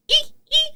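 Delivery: mock frightened squeak, very high. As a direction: squeak like a startled mouse. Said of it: "And Bethany's just like".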